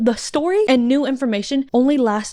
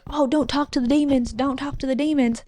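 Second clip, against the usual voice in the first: put-on country accent, a little higher; 4 semitones higher than usual. Reviewer voicing a witch grandmother's warning, country accent. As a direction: warn them in a country twang. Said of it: "said that in a country accent".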